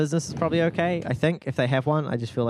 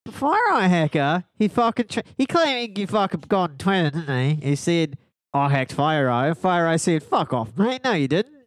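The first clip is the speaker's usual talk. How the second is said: broad nasal Aussie storyteller; mock Australian accent. Telling mate about Twitter claim, put-on Australian accent. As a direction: spin the yarn in pretend pinched Australian. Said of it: "My nose is sore now".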